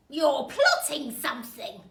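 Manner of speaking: loud, accusing snap at her husband; loud suspicious wife calling him out. Acted. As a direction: bark the accusation across the room. Mrs Twit accusing her husband.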